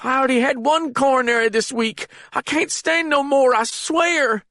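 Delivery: put-on high, panicked frightened old man. A narrator's reading. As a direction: blurt it out in terror. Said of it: "in his panicked state".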